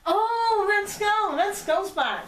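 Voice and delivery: high, loud outburst of excitement; excited exclamation. Acted. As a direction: exclaim it like a thrilled fan. Excited reaction to the cameo.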